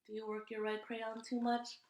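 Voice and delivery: ordinary delivery, nothing marked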